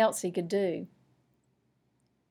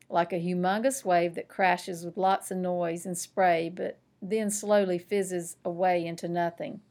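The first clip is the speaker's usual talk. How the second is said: plain reading voice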